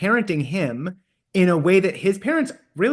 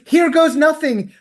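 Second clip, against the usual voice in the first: higher, louder, slow and bracing; brave hopeful quote; 9 semitones higher, 5 dB louder, slower than usual. Retold or imitated. Ken about to run on the beach, hopeful and bracing. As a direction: psych yourself up, slow and bright. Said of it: "he goes".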